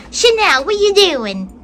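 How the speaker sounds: playful high-pitched hello; excited pet greeting. Real, not acted. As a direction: call out brightly to a favorite cat. Greeting another cat by name.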